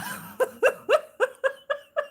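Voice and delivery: long high burst of laughter. Real, not acted. Host laughing at a card from a viewer. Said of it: "I'm about to cry right now".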